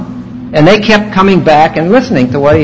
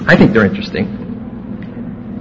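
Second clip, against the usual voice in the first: whispered aside; 8 semitones lower, 9 dB quieter than usual. Real, not acted.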